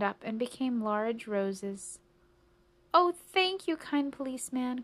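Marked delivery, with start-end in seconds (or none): 2.9-4.8 high, loud burst of thanks; crying out. A narrator's reading. Old lady thanks the policeman for saving bush, delighted cry. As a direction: gush it out, starting high. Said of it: "cried the old lady".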